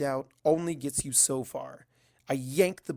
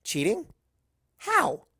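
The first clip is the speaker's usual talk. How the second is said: How shot up high in disbelief; surprised question; 9 semitones higher, 5 dB louder, slower than usual. A narrator's reading. Narrator startled by Starswirl's cheating plan, high surprised question. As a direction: gasp it out, startled. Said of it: "I ask".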